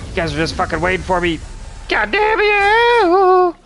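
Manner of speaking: angry yell. Real, not acted. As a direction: yell it out, frustrated, then hold the scream. Dying, teammates waiting on him.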